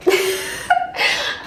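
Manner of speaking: high breathy laugh through tears; teary laugh. Real, not acted. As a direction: laugh to cover the crying.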